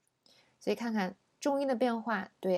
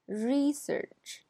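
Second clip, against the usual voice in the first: research with RE higher; noun stress; 6 semitones higher than usual. Shown as a demonstration. Teacher repeats the noun, stress on RE. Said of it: "前面是名词".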